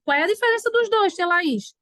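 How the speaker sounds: acted student query, high; imagined pupil. Voices a pupil's question to herself.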